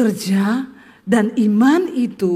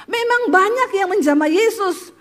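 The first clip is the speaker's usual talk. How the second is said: ordinary talk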